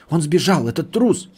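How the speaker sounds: high-pitched, distressed accusation; near tears. A narrator's reading. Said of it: "чуть не плача проговорил Гарпи".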